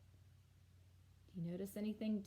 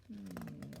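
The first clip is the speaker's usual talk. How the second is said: ordinary delivery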